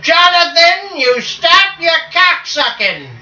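high, slow scolding mom voice; scolding mother. Retold or imitated. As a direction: scold him like an angry mother. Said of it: "My mother, she said".

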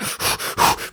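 sharp mock-frenzied gasps; breathy huffs imitating a man losing it; psycho panting. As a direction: gasp like a film psycho. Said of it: "got the psycho head and he's".

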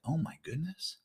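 hushed, high-pitched amazement; small, high awed voice; Peter. Acted. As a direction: gasp it softly, barely daring to believe. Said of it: "he gets on the water and he's like".

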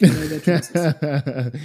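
"trousers" drawn out slowly; a slow mock-insistent correction on "trousers"; firm correction. English mum correcting her daughter's word, mock firm. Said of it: "I'm like".

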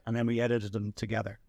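ordinary talk

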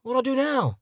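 high-pitched mock plea; pleading young man. Twenty-something asks Japan for help, high mock-desperate plea. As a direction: appeal helplessly, pitched well up. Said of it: "you go to Japan and say".

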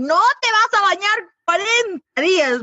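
hammed-up doctor's warning; stern doctor voice. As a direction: lay down the rule like a doctor. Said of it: "me dijeron".

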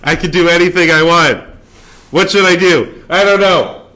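loud and high, giddy; excited. Real, not acted. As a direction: burst out with giddy energy. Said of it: "it's my time".

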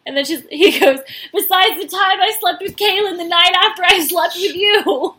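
high, loud mock-whiny protest; indignant protest. Dumped Blake protesting to Christina, mock whiny and loud.

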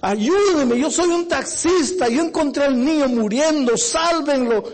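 high, loud desperate plea; tearful begging cry. Acted. Taxi driver in tears begging the doctor, high and loud. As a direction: cry out for them to save him.

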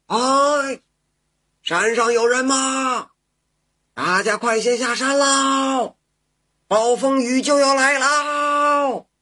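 high, loud call across the mountain. A narrator's reading. Woodcutter shouts to hunters on the mountain, high and loud. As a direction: shout far up the slope to warn everyone. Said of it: "一边走一边喊".

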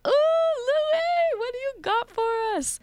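very high, squealing fangirl voice; excited fan squeal. Retold or imitated. Radio host imitating herself as a fan, high excited squeal. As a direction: gush like an eager fan.

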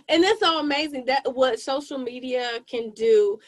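normal talking voice

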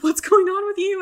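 high, loud imitation of startled concern; alarmed concern. Hotel staff to a guest who starts crying, alarmed.

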